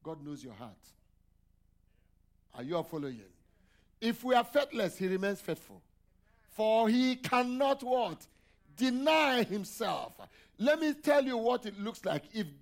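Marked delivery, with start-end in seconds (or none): 4.0-12.2 preaching at full force. Acted. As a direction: lean into every word, loud and higher. Preacher raising voice to congregation.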